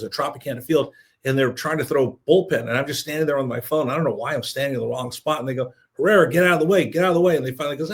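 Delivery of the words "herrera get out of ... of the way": raised, urgent mock yell; retold warning call. Players warn man standing in bullpen, retold warning call. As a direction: call it out louder and higher, half-shouted.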